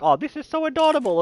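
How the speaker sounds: high, gushing mimic of cooing mums; mock cooing. Dad mocking women gushing over daughter's boyfriend, high cooing voice. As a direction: send up the women gushing over the puppy love.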